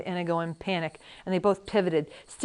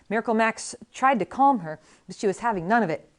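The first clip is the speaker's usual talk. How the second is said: ordinary delivery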